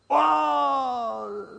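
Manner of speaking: loud, high mock wail of grief; hammed-up wail. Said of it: "the uncle burst out crying".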